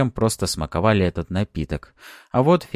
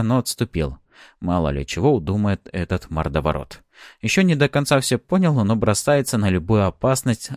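plain reading voice

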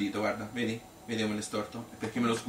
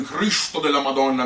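imitating the big-voiced factory owner, louder; mock booming boss; 4 semitones higher, 8 dB louder than usual. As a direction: bellow it like the burly boss, half in jest. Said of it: "con questo vocione".

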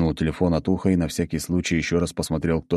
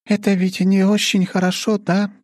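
much higher, slightly louder character voice; worried Ariel; 5 dB louder than usual. A narrator's reading. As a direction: ask anxiously in a high-pitched voice. Female character Ariel voiced by narrator.

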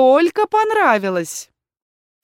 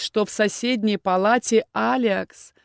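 ordinary delivery

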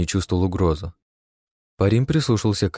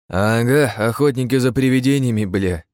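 mocking, higher and louder; 4 semitones higher, 3 dB louder than usual. A narrator's reading. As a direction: sneer it out mockingly. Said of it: "насмешливо передразнил".

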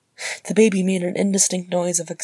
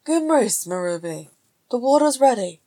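high, singsong call; lifted voice carrying from another room; 6 semitones higher, 4 dB louder than usual. A narrator's reading. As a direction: call to a wife from the kitchen. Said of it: "Beaufort called from the kitchen".

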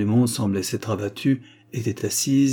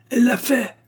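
loud, very high-pitched outburst; angry cry; 5 dB louder than usual. A narrator's reading. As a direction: shriek the accusation furiously. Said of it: "s'écria mademoiselle Lyle avec colère".

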